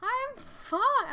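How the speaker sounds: high, loud mock scream; retold screaming. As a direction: imitate a panicked yell at dad. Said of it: "I'm literally screaming at him".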